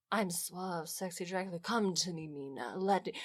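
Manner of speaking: low, soft seductive vampire purr; mock suave Dracula. Podcast host mocking a sexy Dracula, low and soft. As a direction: croon it like a cheesy seducer. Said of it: "he is not".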